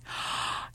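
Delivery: sharp, airy intake of breath; excited gasp. A narrator's reading. Child suddenly spots a Christmas tree, excited gasp.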